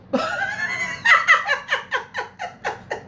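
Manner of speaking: shrieking high cackle of laughter; big high laugh. Real, not acted. Cackling at her own joke to the viewers, high burst of laughter.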